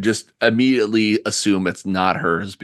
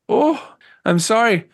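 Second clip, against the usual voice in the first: lightly voiced elderly lady; hesitant, polite plea from a weary hostess; tired old woman; 9 semitones higher than usual. Game master plays old woman.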